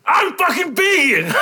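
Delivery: slow, high, loud shouted tantrum; hammed-up vegan rage at a cashier; mock shout. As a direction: slam the counter and yell it slowly. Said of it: "slam your hand out of the fucking, the counter".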